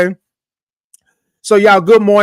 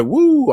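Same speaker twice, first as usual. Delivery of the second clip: high swooping woo; 9 semitones higher than usual. Retold or imitated. Host voices a viewer's typed cheer, high woo. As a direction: let out a whoop that soars up. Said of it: "Lucy said".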